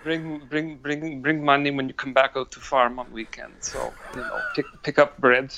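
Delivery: grudging mock Russian accent, halting; lightly hammed Russian accent, words repeated; mad Russian. Shown as a demonstration. Teasing coworker with farm errands, mock Russian accent. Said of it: "sounding like this mad angry Russian".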